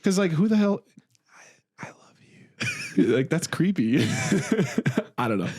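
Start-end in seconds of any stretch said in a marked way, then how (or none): 1.8-3.1 mock intimate whisper with long pauses; straight-up whisper. Shown as a demonstration. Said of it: "as opposed to just straight up whisper".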